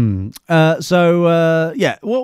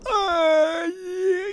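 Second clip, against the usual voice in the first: very high, stretched-out mock groan; Ben impression; 6 dB quieter than usual. Host repeating how Ben sounds in his mind, mocking impression. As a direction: draw it out high and strained. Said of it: "That's what it sounds like in my mind".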